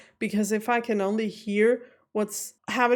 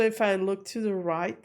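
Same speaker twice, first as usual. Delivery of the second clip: ordinary talk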